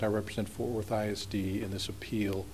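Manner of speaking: real soft, low-volume courtroom introduction; too quiet to hear. Real, not acted. Lawyer introducing his client to appeal judges, too quietly. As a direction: speak too softly for the bench to hear. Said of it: "You have to talk louder".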